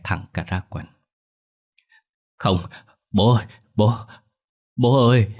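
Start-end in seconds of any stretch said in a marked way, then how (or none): 2.4-5.4 loud, panicked cry for father; tearful wailing. A narrator's reading. Terrified son pleading with his dead father, wailing. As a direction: cry out in mock-sobbing terror. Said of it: "mếu máo gào khóc".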